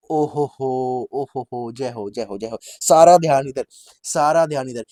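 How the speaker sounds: loud mock call from afar, building to a shout; a theatrical shout of सारा ध्यान इधर. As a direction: hail the two quarrelling men from a distance. A far voice calls for attention.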